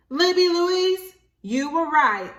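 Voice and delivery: high, loud, slow address; neighbor's voice. Acted. Miss Tulsaberry answering Libby's apology, high and loud. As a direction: call her by full name warmly.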